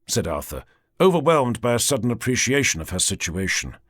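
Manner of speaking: ordinary delivery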